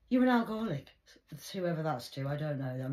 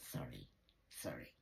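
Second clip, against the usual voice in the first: much lower and much softer, a deep rumbling sorry; deep mock sorry; 13 dB quieter than usual. Vlogger mock-apologising to viewers in a deep rumble. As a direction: growl the apology from the bottom of the voice. Repeats apology in varied voices.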